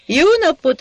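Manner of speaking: high, loud hello; hearty greeting. Acted. Sajó bursting in on Hacsek, loud and high.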